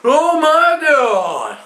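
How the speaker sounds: high drawn-out horrified cry; dad's shocked yell. Retold or imitated. Dad watching his son fall, a high shocked cry. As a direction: yell it slow in shock from the top. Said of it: "My dad's standing up the top going".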